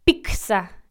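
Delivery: pizza said slowly as "picsa", with a k before s; mispronounced pizza. Shown as a demonstration. Host mocking a common error, exaggerated mispronunciation. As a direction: put a k sound into pizza. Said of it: "como si fuera P I C S A".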